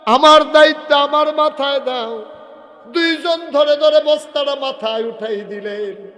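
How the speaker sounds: high, loud preacher's cry; full-force preaching. Acted. Preacher voices Umar demanding his load, at full force. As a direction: thunder it out in indignation.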